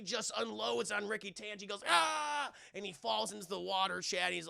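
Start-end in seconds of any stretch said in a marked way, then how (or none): 1.9-2.5 high acted "ah" of a man falling; drawn-out mock cry; hammed-up scream while falling; falling yell. Acts man falling into water.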